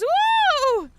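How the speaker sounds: high, loud horror-game whoop; loud woo. Retold or imitated. Streamer imitating her horror-game whoop, high loud cheer. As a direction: whoop like you're hyped at a jump scare. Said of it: "she goes".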